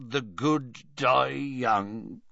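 slow, slurred giant's mumble; drunken mutter. Acted. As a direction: mumble it heavily, half drunk. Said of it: "muttered Hagrid".

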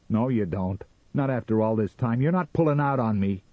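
angry refusal. A narrator's reading. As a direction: refuse her, building in force. Sam refuses his wife's plea.

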